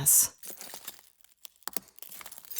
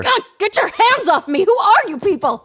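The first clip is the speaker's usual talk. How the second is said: higher, loud furious struggle; angry struggle; 12 semitones higher, 4 dB louder than usual. Acted. Dragged out by agents, furious. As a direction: snap at them while struggling.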